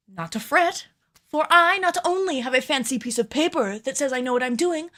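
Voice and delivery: bright upbeat host read; cheerful on-camera presenter voice; presenter intro read. Acted. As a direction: sell the intro like a YouTube host. Reads her video intro script.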